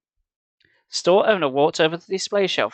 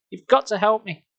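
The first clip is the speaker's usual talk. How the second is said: high desperate pleading; 7 semitones higher than usual. Acted. Desperate plea for help.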